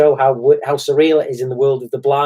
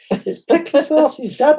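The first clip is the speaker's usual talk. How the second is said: surprise reveal; 12 semitones higher, slower than usual. Retold or imitated.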